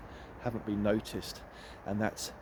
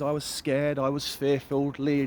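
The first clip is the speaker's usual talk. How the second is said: normal talking voice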